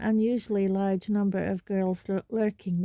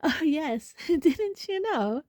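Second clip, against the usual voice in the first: put-on high, girlish giggle through the words; giggly; 8 semitones higher, slower than usual. A narrator's reading. Hermione flaunting her date to Parvati, high and giggly. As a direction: gush it with a giggle, drawn out. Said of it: "with a most un-Hermione-ish giggle".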